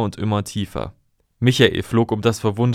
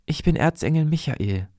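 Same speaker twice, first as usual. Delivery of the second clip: a higher, solemn angel voice; archangel's voice; 6 semitones higher than usual. Acted. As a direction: announce yourself as a heavenly hero. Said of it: "Er sagte".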